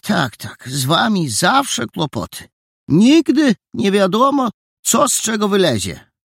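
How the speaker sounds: put-on landlady complaining about the boys; grumbling landlady. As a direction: lament the trouble boys cause.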